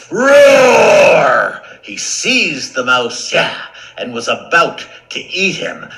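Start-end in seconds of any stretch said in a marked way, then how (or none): 0.1-1.6 long, high, full-force roar; huge shouted roar; lion roar. Acted. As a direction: roar the word like an angry lion. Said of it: "The lion roared".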